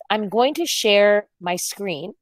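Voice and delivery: normal talking voice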